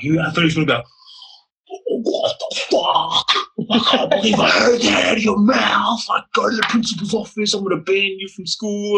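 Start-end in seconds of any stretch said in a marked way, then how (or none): 1.9-9.0 imagined teacher yelling at him; imagined furious yell. Retold or imitated.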